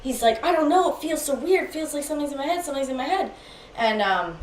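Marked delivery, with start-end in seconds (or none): 0.4-3.3 high, repetitive anxious imitation; retold freak-out. Boyfriend insisting something is in his head, panicky. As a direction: imitate him panicking, pitched well up. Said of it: "after that little freak out".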